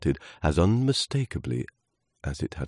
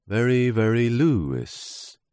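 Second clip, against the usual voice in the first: slow, high, stretched out; drawn-out emphasis; 6 semitones higher, slower than usual. A narrator's reading. Narrator stressing how Lewis the leaf felt, drawn out. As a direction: draw the words out slowly. Storyteller stretches the feeling word.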